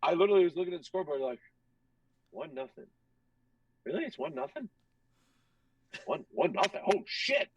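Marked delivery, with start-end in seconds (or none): 2.3-7.5 starts hushed, rises to higher-pitched shock; dawning disbelief. Retold or imitated. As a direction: let the realisation build in stages. Acts out disbelief at scoreboard.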